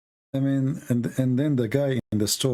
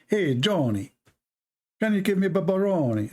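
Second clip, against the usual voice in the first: put-on a light Italian accent, a bit higher; playful Italian accent ordering at the pizza counter; 6 semitones higher than usual. Customer asks Johnny for pepperoni in a light Italian accent. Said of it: "I turned to be Italian".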